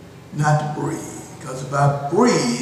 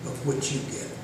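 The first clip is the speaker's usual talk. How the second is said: ordinary talk, nothing marked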